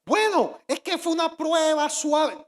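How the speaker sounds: high, drawn-out imagined bystander; making light excuses. Acted. Preacher voicing someone excusing Peter, high and dismissive.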